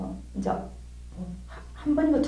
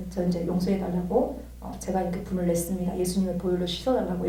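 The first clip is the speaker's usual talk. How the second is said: low, soft plea for forgiveness; subdued, lowered prayer voice; humble confession; 4 semitones lower, 7 dB quieter than usual. Retold or imitated. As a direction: quietly ask to be forgiven. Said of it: "주님께 제 고백을 했어요".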